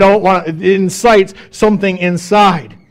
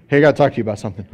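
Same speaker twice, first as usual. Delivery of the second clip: ominous opener; 7 semitones lower, 4 dB quieter, much faster than usual. Retold or imitated. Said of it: "But we all do it".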